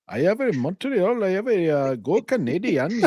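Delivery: put-on high-pitched hammy Canadian accent. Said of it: "if you guys haven't heard my Canadian accent".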